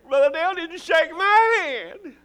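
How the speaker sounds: high, loud mock complaint; whiny churchgoer. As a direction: whine it like a petty churchgoer.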